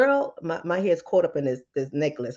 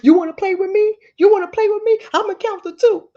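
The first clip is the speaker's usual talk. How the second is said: loud, high mock threat to a child; mama warning a kid, loud and high; threatening mama; 13 semitones higher, 8 dB louder than usual. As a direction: yell a mock countdown at the kid. Mother threatening her kids.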